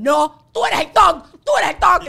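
loud, high, drawn-out mock yell; acted angry retort. Wife yelling back at a cinema stranger, acted outrage. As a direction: fling the insult back at full volume.

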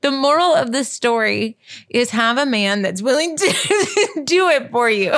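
moral delivered through real laughter; laughing punchline. Real, not acted. Wife finishing the story's moral to husband, laughing. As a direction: break into giggles midway through the sentence.

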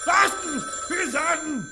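loud high exclamation; sudden loud cry; outburst. Acted. Villain bursting out.

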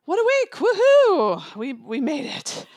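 high, loud whooping celebration; excited cheer. Real, not acted. Cohost celebrating the week's end with her, loud whooping cheer. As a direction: cheer it out like the week is finally over.

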